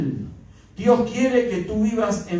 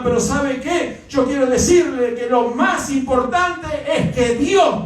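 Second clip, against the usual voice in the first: raised, ringing preacher's voice; fervent preaching; 5 semitones higher than usual. Acted. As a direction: drive it home to the congregation. Preaching rises to full force. (predicando con fervor, voz alta)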